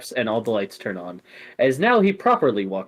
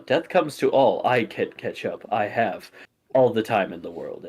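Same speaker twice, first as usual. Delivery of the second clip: usual speaking voice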